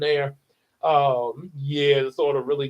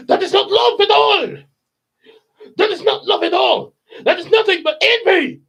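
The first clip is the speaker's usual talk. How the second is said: much higher, louder furious shout; 13 semitones higher, 9 dB louder than usual. Shown as a demonstration. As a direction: roar it like the king in a rage.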